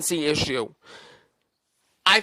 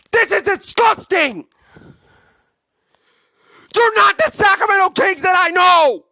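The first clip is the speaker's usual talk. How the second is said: heated rant; 6 semitones higher than usual. Real, not acted. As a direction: yell it like your team just got embarrassed twice. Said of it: "Sorry for my passionate rant".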